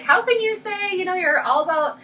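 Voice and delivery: high-pitched mock accuser; put-on indignant challenge, starting very high; mocking troll. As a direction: imitate an online critic in a high voice. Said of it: "troll me on what I do".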